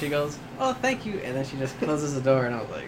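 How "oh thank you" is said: high-pitched imitation thanks; lightly put-on polite lady, pitched way up; sweetly polite lady. Morgue lady takes the package at the door, high polite imitation.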